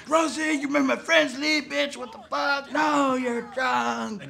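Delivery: high-pitched whining complaint; mock quarrel. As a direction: act out a drunken couple squabbling. Acted drunken quarrel.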